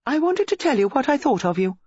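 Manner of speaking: put-on high, petulant young woman; indignant. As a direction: let the boyfriend have it. Acted lovers' quarrel.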